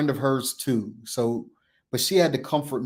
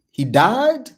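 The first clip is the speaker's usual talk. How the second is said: shocked; 9 semitones higher, 7 dB louder than usual. Retold or imitated. Shocked question about friend's news.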